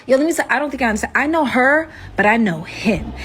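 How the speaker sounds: ordinary delivery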